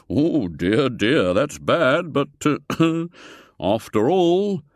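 put-on genial old gentleman. A narrator's reading. Foulard placating.

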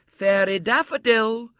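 high, loud, drawn-out announcement; herald's call. A narrator's reading. Fairy herald summoning a player on stage, ringing call. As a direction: call the name slowly across a hall. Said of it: "called the fairy herald".

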